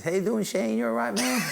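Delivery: high-pitched friendly champ; Mike Tyson. Retold or imitated. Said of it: "First thing he says".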